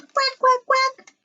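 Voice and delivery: very high, bright quacks; playful duck quacks for kids. Shown as a demonstration. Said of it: "What noise does a pato make?".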